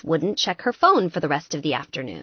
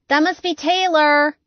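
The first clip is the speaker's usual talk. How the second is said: called out loud and high through a closed door; bellowing; 9 semitones higher, 5 dB louder than usual. A narrator's reading. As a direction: shout it so she hears you outside. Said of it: "Gretchen bellowed".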